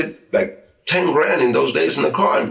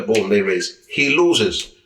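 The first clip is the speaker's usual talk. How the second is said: ordinary talk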